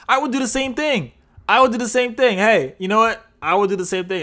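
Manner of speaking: high, loud excited repeat. Real, not acted. Host imagining fleeing rich, excited high repeats. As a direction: get giddy about running off rich. Host gushing he'd flee too.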